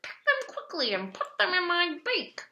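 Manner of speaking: high, pushed pelican voice urging Billy on; urgent pelican voice. A narrator's reading. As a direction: hurry Billy along in a high, eager bird voice. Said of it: "he whispered".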